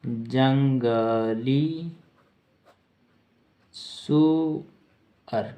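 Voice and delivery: जंगली सुअर broken into long syllables with a big pause between; slow dictation. Shown as a demonstration. Teacher dictating the meaning of boar, syllable by syllable.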